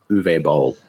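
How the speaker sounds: "Uwe" as OO-veh, then "Boll"; suggested pronunciation. Shown as a demonstration. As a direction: say "Uwe" in two syllables with a V. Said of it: "I'm pretty sure it's".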